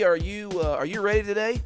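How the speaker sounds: ordinary talk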